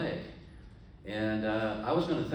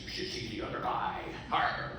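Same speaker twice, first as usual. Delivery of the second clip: pirate brag; 2 semitones higher than usual. Acted. Pirate brags.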